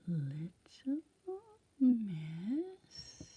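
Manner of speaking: slow, soft hushed whisper; searching whisper. Real, not acted. Reader searching the picture for Sunshine, soft whisper. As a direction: hunt for her in a hush.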